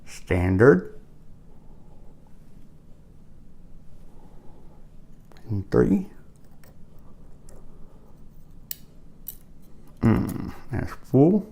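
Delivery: talking around a flashlight held in the mouth; mouth propped open. Real, not acted. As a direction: mumble with something clamped between the teeth. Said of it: "It's hard to talk with a flashlight in your mouth".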